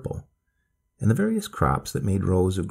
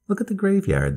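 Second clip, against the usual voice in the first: put-on high, eager old lady; grandma voice; 12 semitones higher than usual. A narrator's reading. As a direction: point it out like a delighted grandma. Grandmother points out graves.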